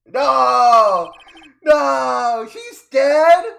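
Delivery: loud, high drawn-out wail; anguished shout. Real, not acted. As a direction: howl in theatrical grief and disbelief. Player's dramatic reaction to death.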